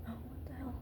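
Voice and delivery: hushed, half-whispered dismay; muttered to herself. Real, not acted. Vlogger muttering at a mishap, hushed. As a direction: mutter it off-mic while fixing something.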